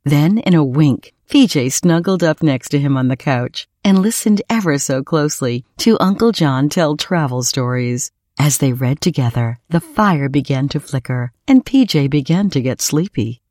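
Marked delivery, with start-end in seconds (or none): none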